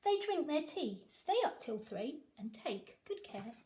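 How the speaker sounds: usual speaking voice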